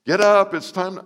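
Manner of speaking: high, loud parental call; mock wake-up call. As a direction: call him out of bed. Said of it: "parent waking child".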